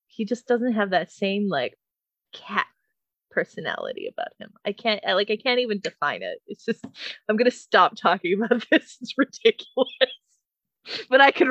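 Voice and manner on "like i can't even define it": usual speaking voice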